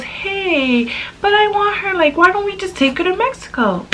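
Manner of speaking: put-on high, childlike plea; little boy voice. Retelling the little boy begging to take her along, high childish voice. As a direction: pitch way up like a small boy asking mom. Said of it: "he goes".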